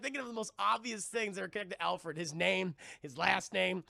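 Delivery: ordinary talk, nothing marked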